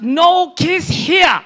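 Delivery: high, very loud, drawn-out refusal; shouted refusal. Retold or imitated. Grocery packer fending off mom, mock outraged shout. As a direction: shout it slowly, pitch way up. Man refuses again, escalating.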